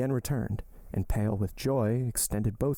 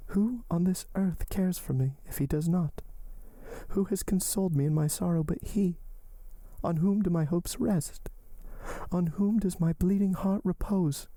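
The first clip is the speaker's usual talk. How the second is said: much higher, pleading heroine voice; desperate young woman; 9 semitones higher than usual. Acted. Heroine entreating her lover to live, high and pleading. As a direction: lift the voice and entreat him.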